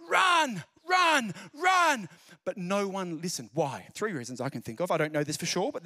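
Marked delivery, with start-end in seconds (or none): 0.1-2.1 high, loud shouted warning; yelling warning. Retold or imitated. Scottish teacher warning beachgoers, loud high shout. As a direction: yell it like a tsunami is coming. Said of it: "yelled at everyone".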